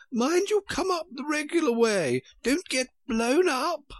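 queen warning the king, still a little out of breath. Acted. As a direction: warn him in the queen's high voice. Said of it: "panted the queen".

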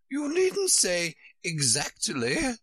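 high, slow Queen voice; drawn-out, high-voiced Queen remark. A narrator's reading. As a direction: correct slowly in a high pitch.

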